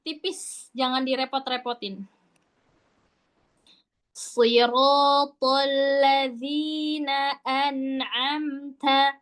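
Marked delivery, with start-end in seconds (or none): 4.2-9.1 slow, sustained tartil; drawn-out model recitation; Quranic recitation. Shown as a demonstration. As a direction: chant it slowly with evenly held syllables. Teacher demonstrates slow recitation.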